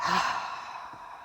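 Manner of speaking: soft, long sigh of release; releasing exhale. Shown as a demonstration. Guide showing listeners how to release shoulders, soft sighing exhale. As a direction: breathe out as the shoulders drop. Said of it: "when you exhale, let the shoulders drop".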